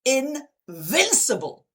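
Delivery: slow, high, emphatic declaration; deliberate, triumphant self-talk; absolute and very deliberate. Acted. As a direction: draw the word out with total conviction. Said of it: "absolute and very deliberate".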